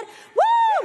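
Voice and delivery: very high, loud "uh!" shout; whoop of praise. Shown as a demonstration. Preacher showing the urge to cry out to God, loud whoop. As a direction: let out a full-force victory whoop. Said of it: "esto a mí me dice hacer".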